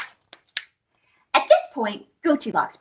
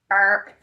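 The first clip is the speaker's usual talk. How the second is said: mock burp; 3 semitones lower than usual. Bears ate the gift.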